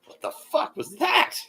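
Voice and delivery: high, slow, stretched-out startled cry; mock shocked gasp. Cafe staff voiced reacting to his outburst, stunned. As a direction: react like the whole room just shook. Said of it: "and they were like".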